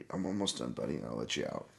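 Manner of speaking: low, hushed aside to the dog; quiet aside. Real, not acted. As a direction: softly reassure a pet off-mic.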